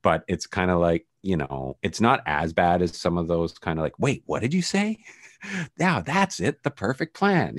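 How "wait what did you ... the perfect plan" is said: high-pitched parody of a contrived line; much higher, hammed-up exclamation; mock exclamation. Podcaster mocking clunky plot lines, hammed-up high voice.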